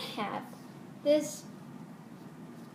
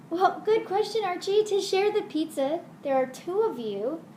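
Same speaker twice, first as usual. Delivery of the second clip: put-on bright TV host explaining to kids; kids show host; 5 semitones higher than usual.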